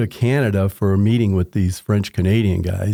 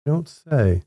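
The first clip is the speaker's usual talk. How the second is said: mock surprise; 6 semitones higher than usual. Reacting to wife's news.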